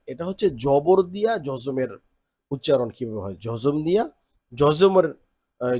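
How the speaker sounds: usual speaking voice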